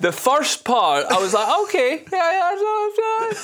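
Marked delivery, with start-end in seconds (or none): 1.6-3.3 very high, squeaky running approval; mock encouraged reaction. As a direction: imitate hopeful nodding-along in a high voice. Retelling reaction to the promising start.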